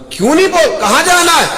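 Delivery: put-on stern uncle shouting down at the boy; angry uncle. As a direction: bark it at the nephew like a furious uncle. Uncle confronts his nephew.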